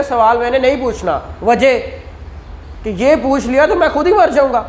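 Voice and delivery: ordinary talk, nothing marked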